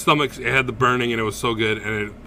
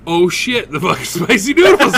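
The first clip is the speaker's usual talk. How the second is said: higher, louder dawning shock; louder mock revelation; sudden realization; 6 semitones higher, 8 dB louder than usual. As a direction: realize it all at once. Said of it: "And then I was like".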